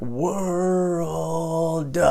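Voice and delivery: drawn-out world, mock loud; teacher overpronouncing world; slow exaggerated word. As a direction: stretch world out like a frustrated teacher. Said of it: "really loud like that".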